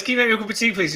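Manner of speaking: playfully hams up asking mum for tea. Retold or imitated. Telling viewers how he asked mum for tea, mock-polite. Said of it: "like, I goes".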